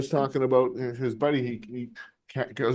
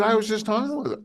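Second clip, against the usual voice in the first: high-pitched retold argument; exasperated comeback; 6 semitones higher than usual. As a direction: replay setting your buddy straight. Retold quarrel with his friend.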